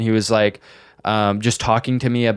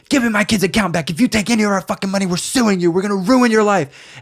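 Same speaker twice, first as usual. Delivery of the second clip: high, fast threatening mother rant; imitated screaming mom; 10 semitones higher than usual. Mom threatens the scammer over the account, screaming. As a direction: tear into the kid as an irate mom. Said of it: "screaming at him".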